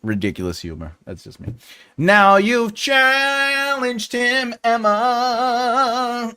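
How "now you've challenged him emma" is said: teasing drawn-out. Real, not acted.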